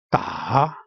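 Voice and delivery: "da" dipping low, then climbing; falling-rising tone. Shown as a demonstration. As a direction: take "da" down and back up. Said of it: "going down and then upward".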